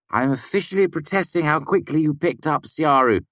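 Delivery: high-pitched indignant protest; mock protest. A narrator's reading. Sim objects to his friend's quick learning, mock protest. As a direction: protest officially, pitched well up.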